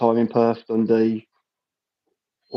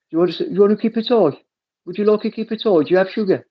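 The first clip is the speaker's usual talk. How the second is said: higher, hammed-up Birmingham accent; Brummie accent; 7 semitones higher than usual. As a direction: offer tea in playful Brummie, pitched up. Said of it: "Is that how the accent makes it sound?".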